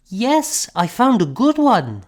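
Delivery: put-on proud small child; eager child's reply. A narrator's reading. Child voiced.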